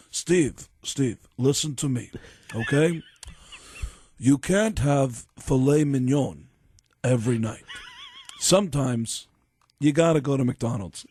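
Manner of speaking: lightly voiced rock star explaining his philosophy. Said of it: "I did this impression of [name]".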